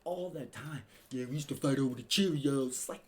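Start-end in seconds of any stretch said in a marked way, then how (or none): 1.1-2.7 casual brag, lightly imitated. Podcaster quoting [name], light mock voice. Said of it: "[name] always says some out-of-pocket". 2.7-3.0 stunned disbelief. Real, not acted. Podcaster reacting in disbelief, hushed high.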